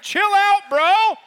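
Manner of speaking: high, loud mock bro; laid-back bro. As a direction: tell them to calm down, dude style. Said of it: "being like".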